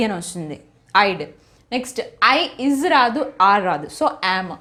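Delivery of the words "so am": normal talking voice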